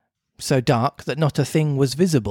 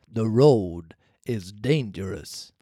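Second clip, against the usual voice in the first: low, slow ghostly cricket; slow low voice of a spirit; talking cricket voice; 6 semitones lower, 4 dB quieter, slower than usual. Acted. The cricket warns.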